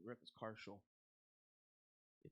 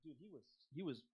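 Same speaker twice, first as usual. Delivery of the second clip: ordinary delivery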